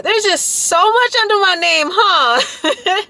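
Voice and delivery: high-pitched laughing through the words; giggling at her own long list of titles. Real, not acted. Vlogger laughing at her own list of titles, giggly.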